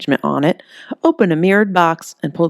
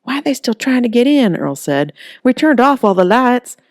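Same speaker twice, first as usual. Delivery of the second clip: higher, loud, anxious; worried; 8 semitones higher, 4 dB louder than usual. A narrator's reading. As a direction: ask it scared. Earl scared.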